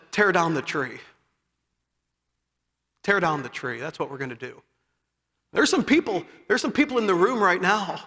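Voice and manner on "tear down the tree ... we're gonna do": quiet solemn command. Retold or imitated. General Lee's solemn answer.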